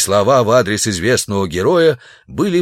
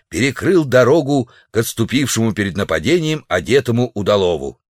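plain reading voice

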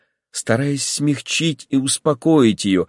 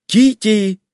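higher, louder call across the house; mother's raised calling voice; 11 semitones higher, 5 dB louder than usual. A narrator's reading. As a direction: call her from the next room. Mother calling from another room.

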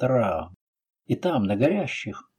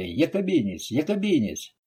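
plain reading voice

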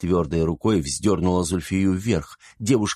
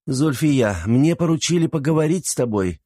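put-on higher, firm reproach to a young girl; stern scolding; 7 semitones higher than usual. A narrator's reading. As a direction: scold her sternly, like an older sister. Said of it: "строго выговаривала женщина девушке".

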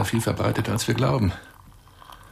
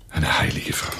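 whisper; 3 semitones lower than usual. Acted. Reverent aside about mother.

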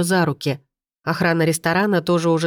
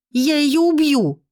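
much higher, slow murderous shriek; hysterical scream; 10 semitones higher, slower than usual. A narrator's reading. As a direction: scream the threat, stretching it. Hysterical attack on rival. (истеричный визг)

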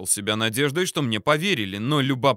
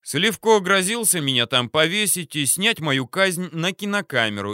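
plain reading voice

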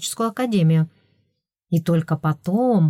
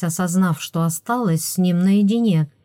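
plain reading voice

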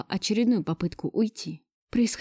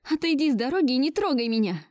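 higher-pitched, agitated demand; near-shriek demand; 6 semitones higher than usual. A narrator's reading. Woman blocking Gleb from her baby, near-shrieking demand. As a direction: demand it, voice climbing toward a squeal. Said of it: "Чуть ли не срываясь на визг, потребовала я".

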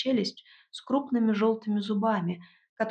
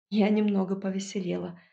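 ordinary delivery

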